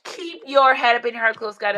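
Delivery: ordinary talk, nothing marked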